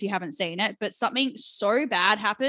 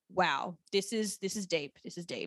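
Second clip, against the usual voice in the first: hushed awe; 3 semitones lower, 9 dB quieter than usual. Retold or imitated. Alone on the couch after a sad episode, stunned hushed murmur. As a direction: let it drop to a stunned hush.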